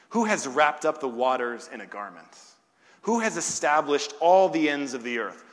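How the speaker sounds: loud, declaimed preacher's questions; ringing rhetorical questions, punched hard at the start; forceful scripture reading. Real, not acted. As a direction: proclaim each question loudly to the congregation. Reads scripture at full force.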